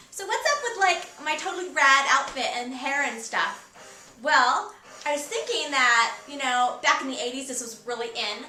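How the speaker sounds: playing an eighties valley girl. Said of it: "stop talking like a valley girl now".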